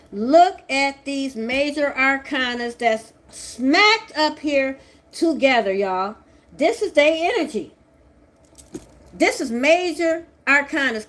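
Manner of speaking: high, loud, slow emphatic pointing; excited. Real, not acted. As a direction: show off the cards triumphantly. Excited showing the tarot cards.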